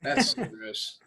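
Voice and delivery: whispered, grossed out; disgusted whisper. Real, not acted. Reacting to the toilet story, disgusted whisper. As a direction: breathe it out in disgust.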